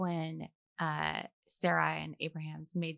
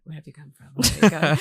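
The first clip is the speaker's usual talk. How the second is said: intoned biblical question; 3 semitones lower, 12 dB louder, much faster than usual. Shown as a demonstration. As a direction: recite it like a voice from scripture, booming at the end. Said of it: "And I'll say, like".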